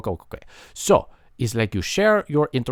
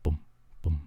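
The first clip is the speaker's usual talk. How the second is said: soft booms; 10 semitones lower, 9 dB quieter than usual. Shown as a demonstration. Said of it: "there's lots of volumes you can go at".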